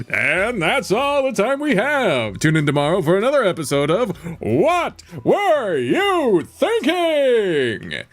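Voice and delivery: high, drawn-out host farewell; theatrical TV host wrapping up; talk-show sign-off. Acted. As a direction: sing out the show's title, stretching the last word high.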